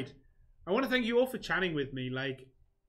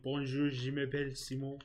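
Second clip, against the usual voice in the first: put-on dodgy French accent. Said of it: "I had to put that stupid accent on".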